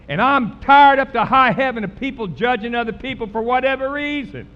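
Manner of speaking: loud, exasperated preaching; full-force preaching. Real, not acted. Preacher tired of people judging others, full-force preaching. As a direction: preach it loudly, fed up.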